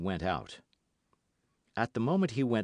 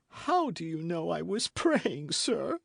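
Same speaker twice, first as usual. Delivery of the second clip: sobbing voice; 9 semitones higher than usual. Acted. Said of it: "in a voice broken by sobs".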